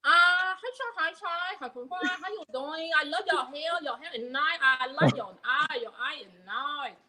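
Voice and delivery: put-on a nail-salon lady's Chinese accent; mock Chinese accent. Showing the hosts a lightly put-on Chinese accent. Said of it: "I could do Chinese".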